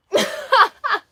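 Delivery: high, loud burst of laughter; loud amused cackle; real laugh. Real, not acted. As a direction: burst out laughing at your own mix-up. Mole mix-up cracks her up.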